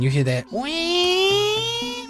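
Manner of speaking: mock infant cry, drawn out and high; imitating a baby's wail. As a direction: wail it slowly like a crying baby. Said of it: "you hear that crying".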